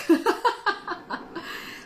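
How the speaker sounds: high burst of laughter; cackle. Real, not acted. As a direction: laugh at your own dark joke. Laughs at her own dark joke.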